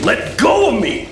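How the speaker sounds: high yell pulling free; struggling shout. Acted. Neon yelling at the officer grabbing him. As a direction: fight off the grip.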